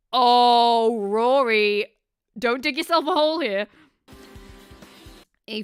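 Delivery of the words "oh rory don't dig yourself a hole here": loud, drawn-out groaning "oh"; loud dismay. Real, not acted. Streamer reacting to Rory's suspicious story, loud dismay. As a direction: stretch it out in loud, level dismay.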